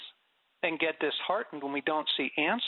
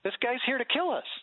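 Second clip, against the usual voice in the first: alarmed protest; 8 semitones higher than usual. Retold or imitated. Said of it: "Ananias is like".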